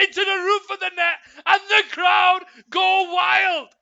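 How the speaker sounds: shouting live play-by-play; excited goal call. Real, not acted. Goal commentary at full force.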